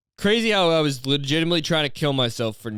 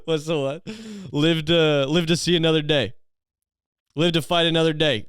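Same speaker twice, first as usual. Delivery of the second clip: put-on drunk bro catchphrases; 5 semitones higher than usual. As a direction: play the hammered guy spouting sayings. Hammered guy's catchphrases.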